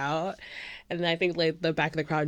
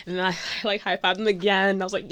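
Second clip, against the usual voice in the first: ordinary talk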